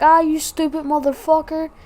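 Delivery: high-pitched imitation of a friend ribbing; mock rude. Imitating friend scolding over the jinx, mock rude. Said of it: "I said it like he was trying to be rude".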